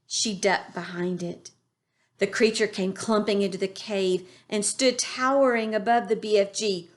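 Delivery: plain reading voice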